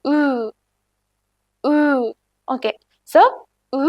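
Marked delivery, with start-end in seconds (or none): 0.1-1.7 "ഉ" through narrowed, rounded lips; narrowed-lip vowel. Shown as a demonstration. Teacher showing learners lip position, "ഉ" with narrowed lips. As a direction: narrow the lips tightly to sound "ഉ". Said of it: "ലിപ്പുകൾ narrow ചെയ്ത് വേണം പറയാൻ".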